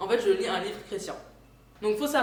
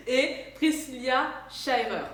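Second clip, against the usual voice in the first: laughing; 5 semitones higher, slower than usual. Real, not acted. Laughing at her own accent.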